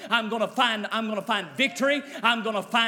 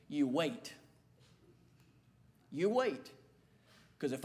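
hushed; 8 semitones lower, 10 dB quieter than usual. Acted. Solemn quiet sermon turn.

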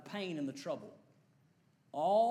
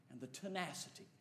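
ordinary talk

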